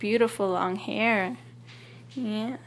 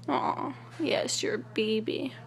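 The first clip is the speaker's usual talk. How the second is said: whispering; 4 dB quieter than usual. Real, not acted. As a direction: whisper tenderly to a pet. Whispering to the cat.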